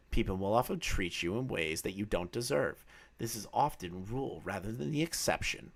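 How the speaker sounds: soft, low, mock-profound read; mock solemn reading. Streamer reads a chat quote in a hushed, mock-deep voice. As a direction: read it like a fake inspirational quote.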